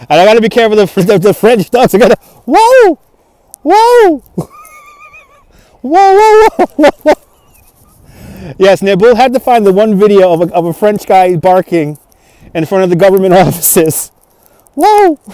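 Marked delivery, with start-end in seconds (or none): 2.4-8.5 high, loud startled whoas; real startled whoa, stretched slow at the end. Real, not acted. Reacts to barking dog video. 14.7-15.2 high, sharp whoa; real startled whoa. Real, not acted. Reacts to barking dog video.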